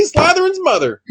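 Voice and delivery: high-pitched imitation of someone; high mock voice. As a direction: squeak it out high. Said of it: "you don't sound anything like that".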